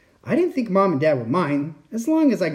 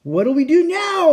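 high, loud cry of alarm; yelling pirate; 12 semitones higher, 5 dB louder than usual. A narrator's reading. Pirate calling to crewmates in a storm, high yell. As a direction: yell it out in a raised pitch. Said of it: "yelled one of the pirates".

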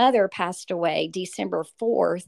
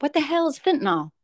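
baffled husband; 7 semitones higher, 3 dB louder, faster than usual. Retold or imitated. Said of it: "my husband's like".

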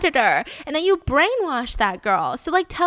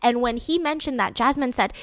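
usual speaking voice

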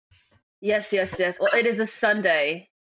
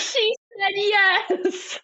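tearful announcement; 11 semitones higher, much slower than usual. Acted. Announcing the accepted proposal.

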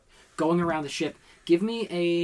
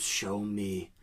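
muttered demand; 5 semitones lower, 5 dB quieter than usual. Acted. Captain pleads with his compass.

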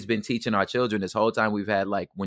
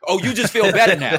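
high, loud sarcastic echo; sarcastic disbelief, pitched high; 10 semitones higher, 6 dB louder than usual. Retold or imitated.

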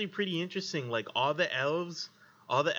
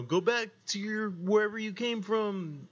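a hint of a higher jeering voice; 7 semitones higher than usual. DM previewing his human NPCs, lightly jeering voice. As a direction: lightly voice a small-minded local. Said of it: "some accents".

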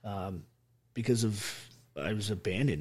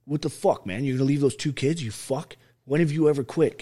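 retelling a mate's angry lecture, raised and louder; dad lecture; 5 semitones higher, 6 dB louder than usual. Retold or imitated. Friend scolding him for giving up, angry lecture. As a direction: chew him out like a stern dad. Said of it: "John gives me the dad lecture".

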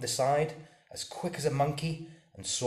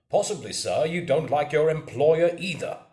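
loud, raised, indignant retort; angry squire; 5 semitones higher, 9 dB louder than usual. A narrator's reading. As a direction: challenge him angrily, pitch climbing. Angry squire challenges the captain again.

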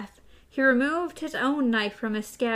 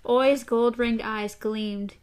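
usual speaking voice

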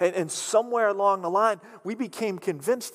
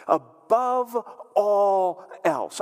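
emphatic; 3 semitones higher, 5 dB louder, much slower than usual. Real, not acted. Preacher repeats key phrase.

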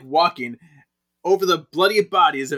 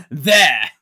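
laughing exclamation; 8 dB louder than usual. Retold or imitated. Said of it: "She's like".

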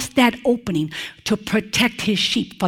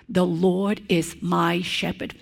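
soft, slow, lower confession; quiet, heartfelt; 5 semitones lower, 7 dB quieter than usual. Pretend. Preacher echoing David's personal words, soft and slow. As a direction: let it sink in, tenderly.

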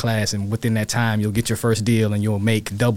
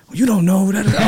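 much higher and louder, snapping back; pissed retort; 10 semitones higher, 6 dB louder than usual. Retold or imitated. Son snapping at his dad about the thirty thousand dollar course, angry. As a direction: cut your dad off, you know better. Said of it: "So I'm pissed at him".

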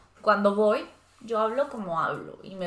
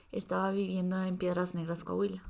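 ordinary talk

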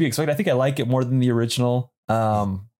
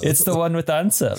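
put-on Scandinavian accent; 6 semitones higher than usual. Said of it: "in, like, a Scandinavian accent".

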